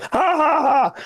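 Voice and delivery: put-on young comic's laugh; mock laugh. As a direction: laugh hammily at your own joke. Mock laugh after imitated lookalike joke.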